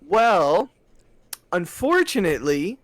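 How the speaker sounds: high, drawn-out customer-service regret; mock-polite refusal. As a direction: stretch it out with fake regret. Acts out refusal to demanding customer.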